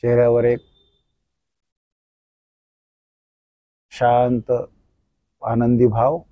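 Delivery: soft, slow guided meditation voice; soft spa whisper. Acted. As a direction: lull them through a gentle body scan. Leads body-scan relaxation meditation.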